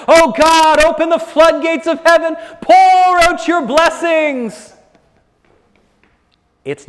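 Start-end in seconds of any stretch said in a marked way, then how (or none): 0.1-4.7 high, loud mock supplication; imitated pleading prayer. Preacher mimicking people who pray for blessings, hammed-up plea. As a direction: cry up to heaven, stretching the last plea. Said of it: "heard people pray".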